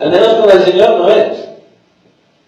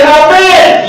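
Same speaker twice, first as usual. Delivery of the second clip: called amen; 6 semitones higher than usual. Shown as a demonstration. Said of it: "la iglesia dice".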